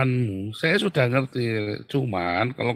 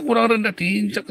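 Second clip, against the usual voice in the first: high, insistent drill call; trainer's command; 9 semitones higher, 4 dB louder than usual. Acted. Trainer to a trainee pressed down, mock command.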